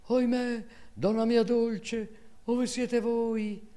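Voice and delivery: high, loud, slow anguished wail; crying out. Acted. Maid cries out for her mistress, a high loud wail. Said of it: "cominciò a gridare".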